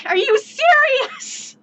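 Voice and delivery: high, slow, crying disbelief; drawn-out, wailing are you serious; incredulous. Acted. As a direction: whine it in total disbelief.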